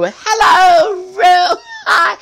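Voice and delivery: very high, shouted impression; Kitty. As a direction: squeal it out loud, hammed up. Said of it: "when Kitty had the nerve to go".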